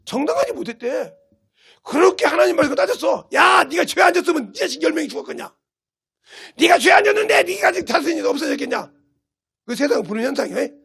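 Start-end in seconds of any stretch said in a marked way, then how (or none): 1.8-8.9 raised, high-pitched scolding; accusing shout. Acted. Preacher voices Job's friends blaming him, accusing shout.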